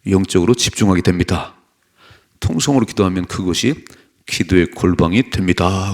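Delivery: loud, declarative preaching; forceful sermon reading. Real, not acted. Preacher reading the point on praying aloud, forceful. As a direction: proclaim each point firmly and loudly.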